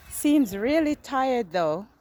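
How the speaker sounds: normal talking voice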